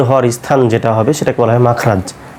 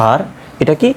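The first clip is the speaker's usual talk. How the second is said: ordinary talk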